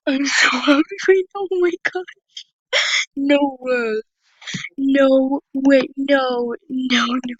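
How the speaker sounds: loud, gasping, near-tearful joy; thrilled. Real, not acted. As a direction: squeal with disbelief at the gift. Gets a rare gift in game.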